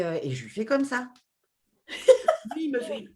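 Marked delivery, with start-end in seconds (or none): 1.9-2.5 a sudden high, loud laugh; burst of laughter. Real, not acted. Laughing as she recalls staring at her husband, loud burst of laughter.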